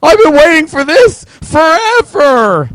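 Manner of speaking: high, loud, squealing fake excitement; mocking fanboy. Ranting at fans, mocks one gushing about waiting forever. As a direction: gush like a fan who swears he waited forever. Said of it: "as are all about".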